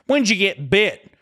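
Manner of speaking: mock her question, drawn out; mocking repeat.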